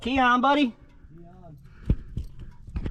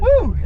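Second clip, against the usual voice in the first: excited whoop; 5 dB louder than usual. Real, not acted. Riding the jeep, cheering.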